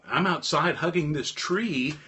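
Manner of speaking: ordinary delivery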